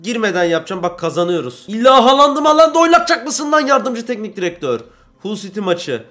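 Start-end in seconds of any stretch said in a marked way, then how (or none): 1.7-4.8 outraged shout at the assistant manager; furious yell. Real, not acted. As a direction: yell higher and louder at the assistant coach.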